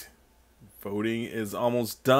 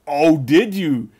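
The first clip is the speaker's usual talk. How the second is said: loud, slow, mock threatening; mock menace; 3 semitones higher, 11 dB louder, slower than usual. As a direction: lean in like a villain.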